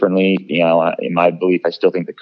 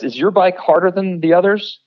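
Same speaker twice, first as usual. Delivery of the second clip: much higher, needling imitation; mock taunting question; 11 semitones higher than usual. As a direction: imitate a teammate's put-down in a high-pitched voice. Retold taunt from shunning teammate.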